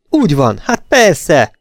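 high, loud, grasping agreement; eager relief. A narrator's reading. Eugene confirms his wife's alibi, excited. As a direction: seize the alibi gladly.

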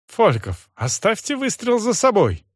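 high, breathless plea; panting out the request; out of breath. Acted. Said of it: "всё ещё задыхаясь". (задыхаясь, умоляюще)

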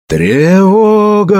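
high, long-drawn warning cry; bellowed alarm. A narrator's reading. Priest raising the alarm from the riverbank, bellowing. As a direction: stretch the word out, calling high and far. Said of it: "заорал он так сильно".

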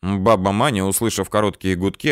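usual speaking voice

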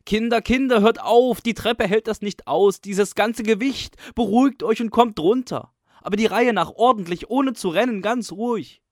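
loud, high, begging call upward; pleading cry. A narrator's reading. Caretaker begging the pupils upstairs, loud pleading cry. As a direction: plead at the top of your voice. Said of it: "ruft flehentlich".

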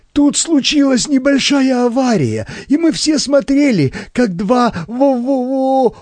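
high, panicky voice breaking into a stammer; frightened stutter. A narrator's reading. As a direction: get stuck on the last word in fear. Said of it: "От страха он начал заикаться".

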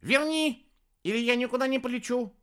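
high, insistent yell, then rushed; shouted threat. A narrator's reading. Хелений threatens not to fly, shouted threat. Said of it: "закричал на кутерьму".

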